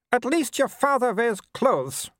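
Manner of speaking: high, disdainful aristocratic lady; haughty Baroness. A narrator's reading. Mother scorning Vimes to her son, high haughty lady. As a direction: sniff down at everyone like a grand dame.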